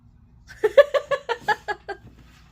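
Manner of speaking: loud high burst of laughter; laughing fit. Real, not acted. Laughing at a card.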